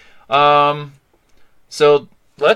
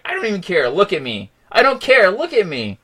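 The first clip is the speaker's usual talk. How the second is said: high-pitched, indignant brush-off; retold defiance, pitch leaping up then falling; defiant outburst; 8 semitones higher, 3 dB louder than usual. Fan retelling his reaction to people staring, defiant. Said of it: "I was like".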